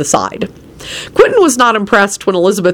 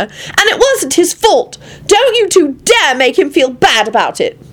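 high, drawn-out defensive outburst; shouted scolding; 10 semitones higher, slower than usual. A narrator's reading. Elizabeth defending Lupin to the two, shouting. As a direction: defend him hotly in a high voice. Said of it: "Elizabeth shouted at Quentin and Lucretia".

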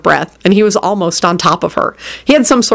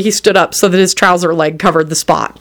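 plain reading voice

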